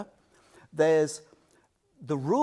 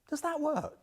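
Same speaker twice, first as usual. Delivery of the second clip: the Buddha asking; 11 semitones higher, 3 dB quieter than usual. Retold or imitated. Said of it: "and he goes".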